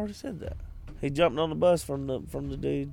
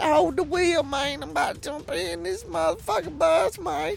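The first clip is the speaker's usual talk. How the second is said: higher, hyped slang voice; put-on street accent; 14 semitones higher than usual. Cop telling driver to hold the wheel. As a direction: cop amped before a stunt jump. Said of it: "You put all the wrong accents on people".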